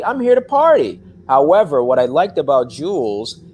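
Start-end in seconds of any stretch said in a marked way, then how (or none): 0.0-1.0 pitched way up, loud, carefree party guy; party-animal voice. Pretend. Actor voicing his stoner jock character Jules, carefree and high. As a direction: toss it off like a kid who only wants to party.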